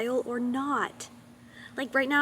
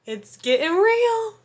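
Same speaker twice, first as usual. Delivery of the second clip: dramatic; 8 semitones higher, 6 dB louder, slower than usual. Pretend. Dramatic repeat.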